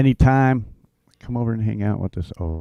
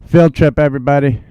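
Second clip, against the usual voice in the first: excited call; 6 semitones higher, 8 dB louder than usual. Real, not acted. Calling everyone to the premiere.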